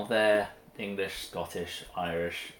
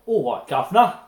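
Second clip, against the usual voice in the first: higher, much louder cockney "All right, Governor" rising; 7 semitones higher, 12 dB louder than usual. Retold or imitated. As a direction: belt the cockney greeting.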